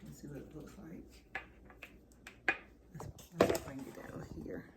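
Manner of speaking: half-whispered aside to herself. Real, not acted. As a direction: murmur it under your breath, almost no voice. Whispering to herself while cooking.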